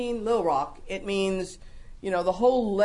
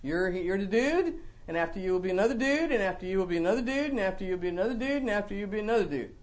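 rattled-off repetition; faster than usual. Shown as a demonstration. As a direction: reel off identical phrases like an endless list. Mocking an endless line of successors.

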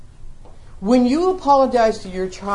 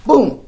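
mock boom; 5 semitones higher, 8 dB louder than usual. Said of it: "The man does this".

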